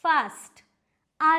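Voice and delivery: फास्ट and आ said loud with a long open aa. Shown as a demonstration. Teacher showing the kids the long a sound, loud. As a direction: stretch the a in फास्ट into aa. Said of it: "the same ए को क्लास".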